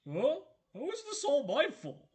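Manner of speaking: a wounded big lug pleading; detective protesting to the prosecutor, whiny. Acted. Gumshoe voice, put-on.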